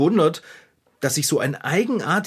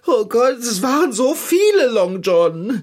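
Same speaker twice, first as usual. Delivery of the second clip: higher and louder in rising alarm; panicked outburst; 7 semitones higher, 4 dB louder, slower than usual. Acted. Telling the cat about the mussels, panicked. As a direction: blurt it out in horror. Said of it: "ganz ruhig. Atme".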